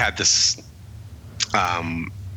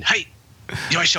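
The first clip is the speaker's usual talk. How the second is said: high chirpy "yoisho" like lifting something; mock eager effort grunt; 9 semitones higher than usual. Junior shows off effort for the boss, chirpy yoisho. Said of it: "me going".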